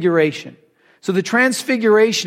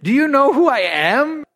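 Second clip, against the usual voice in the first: higher, incredulous challenge; imitating someone taken aback; mock indignation; 4 semitones higher than usual. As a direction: play affronted disbelief, pitched up. Acts out Jesus taken aback.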